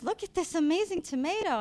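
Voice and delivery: retold childlike excitement, swooping up high; excited kid. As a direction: proudly show off an amazing find. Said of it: "and she says".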